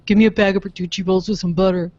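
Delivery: a touch of character in the order; acted-out order. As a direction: own food order, lightly hammed up. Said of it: "you said it like that".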